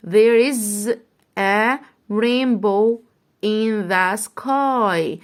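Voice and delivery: very slow "There is a rainbow in the sky"; slow read-along. Shown as a demonstration. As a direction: read slowly with the class. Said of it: "اقرأ معايا".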